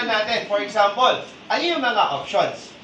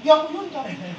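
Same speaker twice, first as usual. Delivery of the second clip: lightly voiced whiny denial, a bit higher; mock denial; 6 semitones higher, much faster than usual. Speaker mimicking someone refusing blame, light mock voice. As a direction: play a lightly voiced dodger denying it. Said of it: "Hindi yung".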